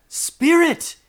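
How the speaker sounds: very high, loud call; desperate cry. A narrator's reading. Scrooge clutching the spirit's robe, desperate cry. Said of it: "he cried".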